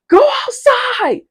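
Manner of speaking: high, slow drawn-out yell through the door. Retold or imitated. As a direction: shout him away from the bathroom. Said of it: "I start yelling at him".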